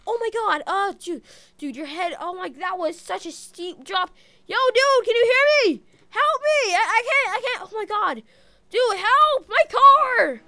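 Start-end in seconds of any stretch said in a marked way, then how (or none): 4.4-10.4 high, loud calls for help; shouting for help. Real, not acted. Driver yelling to a stranger for help after the crash, loud and desperate. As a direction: yell out to be heard.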